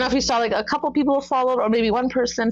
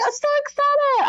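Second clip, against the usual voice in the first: very high, gushing I'm so excited; excited squeal. Retold or imitated. Fan next to [name], squealing with excitement. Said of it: "I was like".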